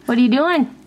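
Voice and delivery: ordinary talk, nothing marked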